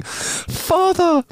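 high, drawn-out joyful cry; tearful joy. Acted. Jesus calling to the old man he takes for his father, overjoyed. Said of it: "burst into tears of joy and held out his arms".

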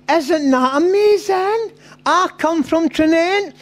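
put-on high, drawn-out gushing girl voice; amazed girl. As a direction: gush slowly in a high put-on girl's voice. Girl delighted at shared home village.

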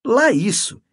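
high, loud burst of agreement; exclaiming. A narrator's reading. Canon praising the woman to Mendes, exclaiming. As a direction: exclaim it, pitched well up. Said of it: "exclamou o cônego".

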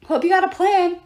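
high, loud shout. A narrator's reading. Coach shouting to Leo at the helm, loud. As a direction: yell it over the battle. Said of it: "he shouted".

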